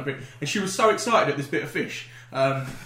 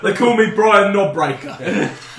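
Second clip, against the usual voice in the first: loud, very slow put-on growly intro; hammed-up tough name; 4 semitones higher, 9 dB louder, much slower than usual. Friends riffing a massage parlour, loud slow mock introduction. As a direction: drag out the silly name. Comic masseur bit.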